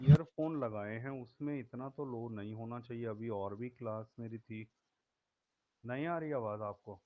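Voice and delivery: faint and fast; rushed, faint mic voice; barely audible. Real, not acted. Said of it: "sir, लो आ रही है".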